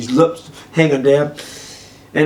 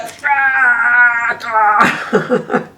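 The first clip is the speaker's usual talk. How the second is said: long raised screech breaking into laughter; imitated cat yowl; 9 semitones higher than usual. Cat shredding his head, imitated screech. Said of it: "It's like".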